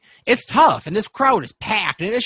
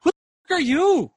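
a much higher, snapping challenge; girl pulled from a kiss, snapping at the intruder; angry girl; 11 semitones higher than usual. Acted. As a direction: bark it at the stranger who barged in. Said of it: "looks at you and goes".

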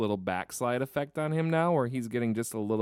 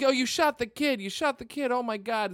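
high, shrill-free panicked cry of shock; mock panicked agent; 11 semitones higher than usual. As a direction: squeal it out like a horrified rookie. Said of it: "It's like".

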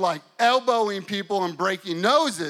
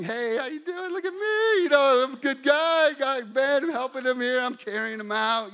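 high, chirpy greeting to onlookers; hammed-up friendly good guy; cheery show-off; 6 semitones higher, 4 dB louder than usual. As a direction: sing out proudly so everyone notices your good deed. Showing off as helpful good guy.

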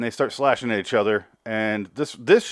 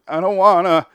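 high, loud put-on whine; mock whiny refusal; 9 semitones higher, 9 dB louder than usual. Xavier refusing to kill her, high loud mock whine. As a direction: whine it like a sulky kid. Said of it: "And he's like".

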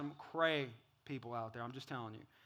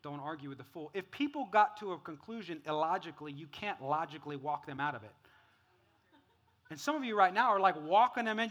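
ordinary talk

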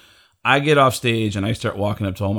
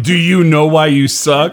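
loud, raised retold question; blunt challenge; 4 semitones higher, 7 dB louder than usual. Comic retelling his friend's jarring verdict, loud blunt challenge. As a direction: throw the blunt question out loudly.

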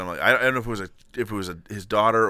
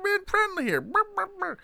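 silly high voice; 4 dB quieter than usual. Pretend. Joking about sponsor check.

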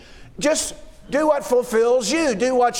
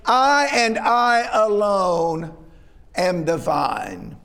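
slow, weighty "I and I alone", dropping low on "am the vine"; emphatic slow stress; 3 semitones lower, slower than usual. Retold or imitated. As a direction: stress each "I" slowly, as if only he could claim it. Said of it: "Almost as if he were saying".

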